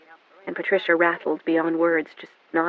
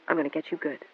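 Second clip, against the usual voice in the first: softer, dropped-down muttered vow; 8 dB quieter, faster than usual. A narrator's reading. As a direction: let it sink into a quiet grim promise. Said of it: "he muttered".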